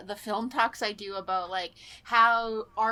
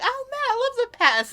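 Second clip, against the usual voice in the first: high, louder mock-fan enthusiasm; imitated gushing fan; 13 semitones higher, 5 dB louder, faster than usual. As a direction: gush like an overexcited grown-up fan. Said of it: "thirty-year-olds coming up to him now going".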